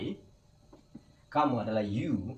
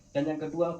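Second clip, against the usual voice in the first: usual speaking voice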